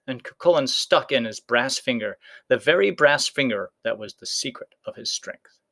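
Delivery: usual speaking voice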